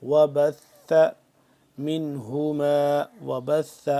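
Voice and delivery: slow, drawn-out chanted tilawat; Quran recitation. Shown as a demonstration. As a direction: recite melodically, stretching every vowel. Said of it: "زا کو سختی سے".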